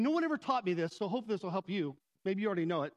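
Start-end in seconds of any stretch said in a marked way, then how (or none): none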